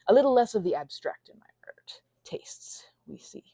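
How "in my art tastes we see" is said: whisper. Real, not acted. Whispered aside.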